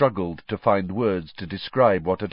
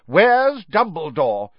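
loud, slow, raised demand; 8 semitones higher, 6 dB louder, slower than usual. Acted. Fudge demanding Dumbledore's whereabouts from Mrs Weasley. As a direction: insist on an answer now. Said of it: "Fudge demanded".

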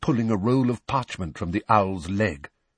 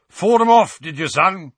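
high, booming, hearty question; loud; 9 semitones higher, 7 dB louder than usual. A narrator's reading. Uncle proudly questions his son, loud. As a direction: boom it out to the boy. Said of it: "said Uncle Vernon loudly".

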